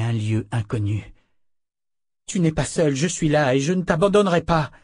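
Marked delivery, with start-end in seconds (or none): 2.3-4.7 higher, louder pleading call; urgent plea. A narrator's reading. Richard begging Kalan to look at him, urgent and loud. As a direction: hold on to her with your voice.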